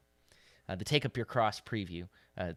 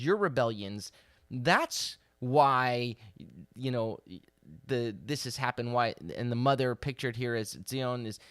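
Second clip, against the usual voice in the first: louder, impassioned sermon voice; fervent preaching; 3 semitones higher, 5 dB louder than usual. Acted. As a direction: proclaim it like God pleading with his people. Preaches God's words with rising force.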